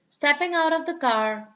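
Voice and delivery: ordinary delivery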